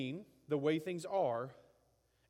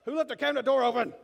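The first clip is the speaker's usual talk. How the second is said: loud, high indignant yell; hammed-up furious outburst after hitting your head; angry shout; 8 semitones higher, 11 dB louder, faster than usual. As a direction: blame the world at the top of your voice.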